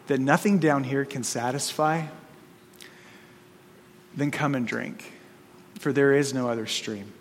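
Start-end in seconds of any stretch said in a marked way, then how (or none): 4.2-7.1 quiet appeal. Real, not acted.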